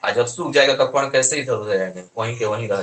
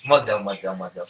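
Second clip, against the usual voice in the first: lightly voiced albumin, low and hushed; softly acted plea, lower than the teaching voice; pleading protein; 7 semitones lower, 7 dB quieter, faster than usual. Teacher playing albumin begging water to stay, lightly voiced hushed plea. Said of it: "लेकिन albumin का".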